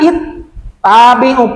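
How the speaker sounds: "it, tabi'u" broken by a pause; cut-off sound. Shown as a demonstration. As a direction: stop the voice after "it", then say "tabi'u". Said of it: "putus suaranya tuh".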